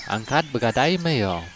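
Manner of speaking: ordinary delivery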